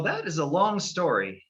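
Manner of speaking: ordinary delivery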